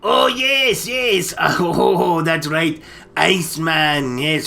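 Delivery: usual speaking voice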